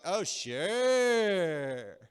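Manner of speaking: loud, long-stretched sarcastic drawl; mock someone's good effort with drawn-out words. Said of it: "you're kinda mocking 'em".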